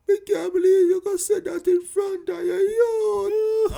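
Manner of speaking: high tearful shout; hammed-up tearful yell; crying outrage. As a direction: wail it out offended. Mother scolds in front of child.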